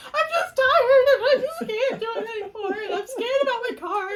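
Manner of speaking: mock bawling, half laughing at yourself; a hammed-up sob while giggling; laugh-crying. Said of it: "I start bawling".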